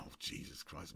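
breathy, near-whispered dismay; soft stunned whisper; dismayed breath. Retold or imitated. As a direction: mutter it under your breath. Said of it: "I go".

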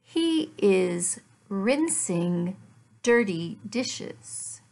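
ordinary delivery

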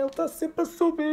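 high squeal breaking into laughter; laughing exasperation. Real, not acted. Streamer to chat about endless transfer market, laughing squeal.

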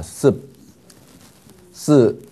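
ordinary talk, nothing marked

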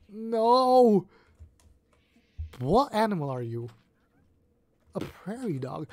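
normal talking voice

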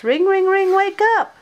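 high loud alarm-clock ringing; playful shouted alarm ring; clock shouting. A narrator's reading. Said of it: "The clock shouts".

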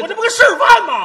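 high, very loud self-defence; comically overheated yelling; indignant shout. Acted. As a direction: protest at full volume. Defends himself in acted quarrel.

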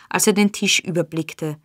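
plain reading voice

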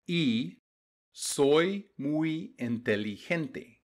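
slow, careful reading of each word. Shown as a demonstration. Teacher reading slowly to students, word by word. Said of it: "I'm gonna read the story to you slowly".

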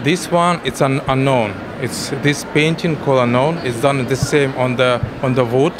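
Slavic-accented halting English; russian accent. Real, not acted. Painter describes his work.

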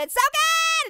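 high, gushing shout of praise; gushing high squeal of delight; excited high squeal. Pretend. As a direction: overdo the praise. Exaggerated outro praise.

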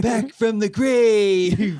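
slow, stretched celebrity impression; Ronald Reagan doing Jack Nicholson. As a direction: draw it out like a lazy film star. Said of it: "Ronald Reagan doing his Jack Nicholson impression".

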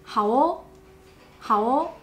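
好哦 said brightly and friendly, a smile in it; cheerful okay. Shown as a demonstration. Teacher demoing a cheerful 好哦 to a student. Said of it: "makes you sound more cheerful".

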